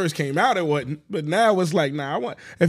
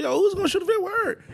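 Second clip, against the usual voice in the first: lightly mimicked friends, pitched up; mock quote; 10 semitones higher than usual. Teasing y'all who didn't go, lightly mimicked in a higher voice.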